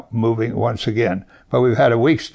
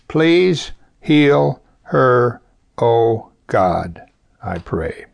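slow prayer; much slower than usual. Shown as a demonstration. Preacher models the short prayer for listeners, very slow and sinking. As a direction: linger on each word, let the end sink away. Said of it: "That's the prayer for today".

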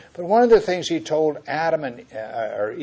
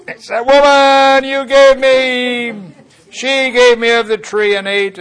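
whiny excuse; 11 semitones higher, 14 dB louder than usual. Acted. Adam's whining excuse to God.

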